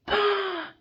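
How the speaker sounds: sharp high gasp. Acted. Tarot reader gasping at a card, sharp and high. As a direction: gasp in shock.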